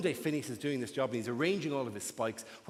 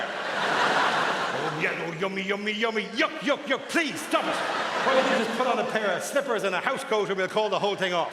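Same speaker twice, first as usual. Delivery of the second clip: mock disgusted lover; 2 semitones higher, 4 dB louder than usual. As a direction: recoil from the outfit in hammed-up disgust. Lover reacting to outfits.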